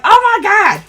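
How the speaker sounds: high, put-on "oh my God"; mock amazement. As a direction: act out being stunned. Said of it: "I'm like".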